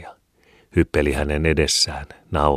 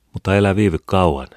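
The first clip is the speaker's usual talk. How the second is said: a mother's firm warning, a bit louder; 4 semitones higher, 6 dB louder than usual. A narrator's reading. Mother warns son heading out for food, firmly. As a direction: caution him not to linger, raising the voice a little. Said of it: "varoitti hän".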